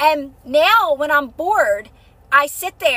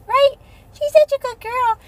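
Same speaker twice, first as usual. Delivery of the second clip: doting pet voice; 10 semitones higher than usual. Real, not acted. Praising her little dog.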